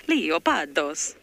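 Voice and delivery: slow, loud Italian leopardos; Italian accent. Shown as a demonstration. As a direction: stretch out leopardos, Italian style. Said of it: "I think that sounds more Italian".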